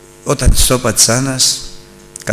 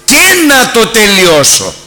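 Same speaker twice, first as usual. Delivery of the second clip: slow, louder, higher preaching voice; impassioned preaching; 7 semitones higher, 6 dB louder, slower than usual. Acted. Preacher giving Christ's answer to the disciples, impassioned. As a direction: proclaim Christ's words with rising force.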